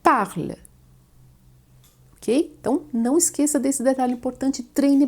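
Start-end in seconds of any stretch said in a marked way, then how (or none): 0.0-0.6 Parle said as PARL, final e silent; short parle with a mute e; French parle. Shown as a demonstration. Teacher models the silent ending for students. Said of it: "pronunciadas assim".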